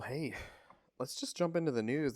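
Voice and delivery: ordinary talk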